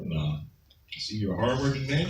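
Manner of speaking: softly imitating the judge's kind words; soft judge praise. The judge praising him before sentencing, softly. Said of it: "and the judge say".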